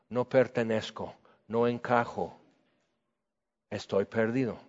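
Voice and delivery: quiet confession. Acted. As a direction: confess it softly to yourself. Sinner's inner confession.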